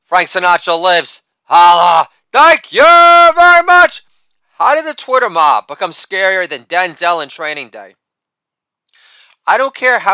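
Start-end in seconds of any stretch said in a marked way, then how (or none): 1.4-4.0 Holla barked loud, then thank you very much stretched slow and very high; shouted catchphrase. Acted. Comic to audience, hammed-up sign-off, loud high drawn-out shout. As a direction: belt the sign-off like a showman milking applause.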